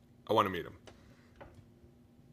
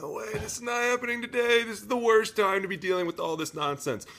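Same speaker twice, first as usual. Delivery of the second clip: much higher, pleading exasperation; exasperated player; 11 semitones higher than usual. Retold or imitated. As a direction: play a fed-up player at wit's end. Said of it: "you're like".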